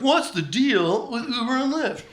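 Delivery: high, slow mock stand-up setup; hack comic voice. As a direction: parody an old-school observational comic. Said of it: "imagine ... then being like".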